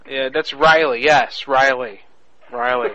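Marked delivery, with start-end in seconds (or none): 2.5-2.9 a high, teasing call of the name; high mock name. Real, not acted. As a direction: toss the name up high, playfully. Playful repeat of the name.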